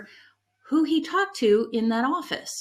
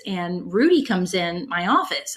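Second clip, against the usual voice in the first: normal talking voice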